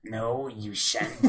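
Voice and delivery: low, drawn-out devilish refusal; slow, softened tempter's voice; Mephisto. Acted. Devil overruling Jeffy, low and drawn out. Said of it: "I'll do it in Mephisto voice".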